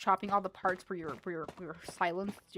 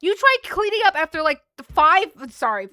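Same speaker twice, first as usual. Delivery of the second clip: loud, raised-pitch venting; exasperated rant; 8 semitones higher, 12 dB louder than usual. Real, not acted. Older sibling venting about cleanup, loud exasperated rant. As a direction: rant at full tilt in exasperation.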